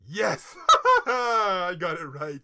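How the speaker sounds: loud, slow, laughing triumph; laughing with joy. Real, not acted. Celebrating her first correct quiz answer, laughing. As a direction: laugh through the win.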